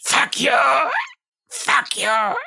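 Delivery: high strangled goblin voice; little goblin. Acted. Choked goblin swearing back, high squeal.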